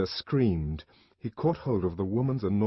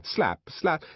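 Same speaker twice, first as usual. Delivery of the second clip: plain reading voice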